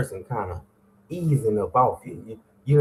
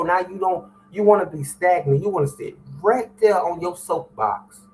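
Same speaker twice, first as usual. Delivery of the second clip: fired-up sermon voice, pitched up and driving; preaching at full force; 6 semitones higher than usual. Acted. Host preaching to his morning listeners, fired up. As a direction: preach it hard to the congregation.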